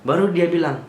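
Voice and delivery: ordinary delivery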